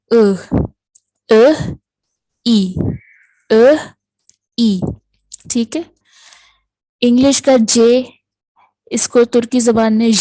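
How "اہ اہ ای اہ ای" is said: اہ said as a short muffled uh, ای as a clear ee; teacher contrasting ı and i; Turkish vowel contrast. Shown as a demonstration. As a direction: alternate اہ as a dotless ı and ای as dotted i. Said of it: "اس کو ہم اہ کہتے ہیں".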